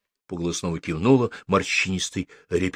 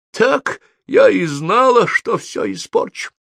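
high-pitched cry of dismay; dismayed gasp; 12 semitones higher than usual. A narrator's reading. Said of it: "Ахнула Софи". (испуганно ахнуть)

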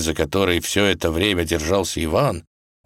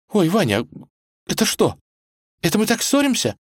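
high, small frightened voice; halting, high bewildered questions; gasping alarm. Acted. Girl clings to Ivan as the earth shakes, alarmed. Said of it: "ахнула она".

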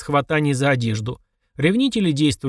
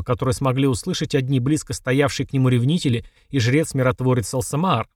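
ordinary delivery, nothing marked

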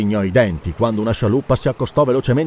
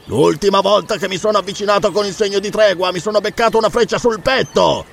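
high, aggrieved shout; pirate calling out angrily, pitched up; roared complaint; 7 semitones higher than usual. A narrator's reading. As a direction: roar your grievance up at the fort. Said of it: "ruggì il pirata".

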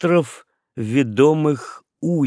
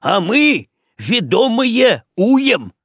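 much higher, loud, slow shout; yelling; 9 semitones higher, 5 dB louder, slower than usual. A narrator's reading. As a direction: shout back proudly. Figures yell back.

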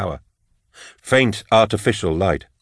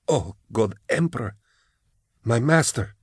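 soft, breath-caught astonishment; gasped shock; 3 semitones higher, 5 dB quieter than usual. A narrator's reading. Kara sees her old master again, gasping in shock. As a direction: gasp it out, stunned and hushed. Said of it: "she gasped".

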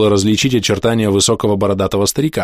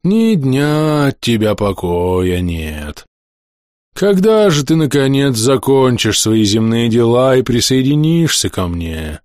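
put-on slow, sighing old ghost voice; drawn-out weary ghostly complaint; grumbling ghost; slower than usual.

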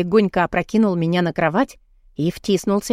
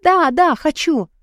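high, urgent outburst; eager pleading; 6 semitones higher than usual. A narrator's reading. Woman agreeing to Adam's proposal, high eager pleading. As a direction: beg eagerly, voice lifted high. Aroused woman begging her lover.

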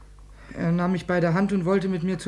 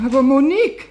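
loud, high pleading cry; desperate plea; 8 semitones higher, 8 dB louder than usual. Acted. Boy begging his girlfriend as she dumps him, loud and pleading. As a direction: beg her not to go.

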